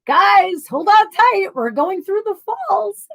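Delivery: high-pitched excited mock announcement; mock boat-guide call. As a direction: call out to passengers as a hammed-up guide. Mimics boat guide warning passengers.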